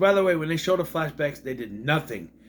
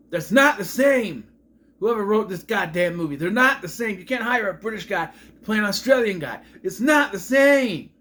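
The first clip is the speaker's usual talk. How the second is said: exasperated rant; 4 semitones higher, 4 dB louder than usual. Real, not acted. Reviewer railing at whoever wrote this movie, exasperated rant. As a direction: rant indignantly, stretching the final refrain. Ranting at British actor playing Australian.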